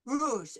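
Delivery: rouge with the tongue pulled back; throaty r. Shown as a demonstration. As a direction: say rouge with a uvular r. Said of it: "we pull the tongue back".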